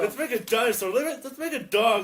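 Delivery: high and loud, tumbling with ideas; imitating classmates shouting out ideas, excited; eager mock excitement. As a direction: pitch it like kids shouting suggestions.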